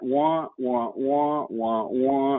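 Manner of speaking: muffled trombone-like wah wah; Charlie Brown adult wah. Retold or imitated. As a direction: mimic the droning cartoon adult. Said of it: "sound kinda like Charlie Brown's mother".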